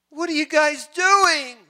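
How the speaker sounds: high, slow mock exclamation; imagined exasperated call, high and drawn out; incredulous outcry. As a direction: cry it out high, stretching the words. God imagined asking, repeated as outcry.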